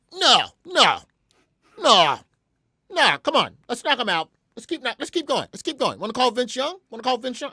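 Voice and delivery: high, loud, repetitive insistence; panicky denial. Retold or imitated. Studio crew urging him to keep going, panicky denial. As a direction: deny it shrilly, then push on hurriedly. Panicked others urge carrying on.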